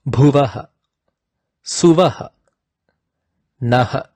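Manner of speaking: each final "ha" of "Bhuvaha, Suvaha, Naha" breathed out; visarga breath. Shown as a demonstration. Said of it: "It resembles an expiration sound".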